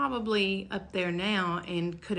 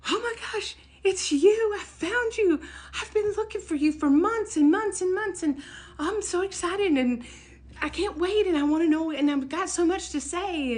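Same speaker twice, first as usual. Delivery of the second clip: much higher and louder, tumbling with joy; thrilled gushing; 10 semitones higher, 4 dB louder than usual. Retold or imitated. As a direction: gush like you just won the lottery. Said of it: "I felt like I'd won the lottery".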